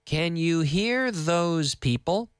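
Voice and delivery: "Can you hear those people?" said slowly for repeating; slow it down for the learners; slow, clear model sentence. Shown as a demonstration. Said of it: "Y más lento".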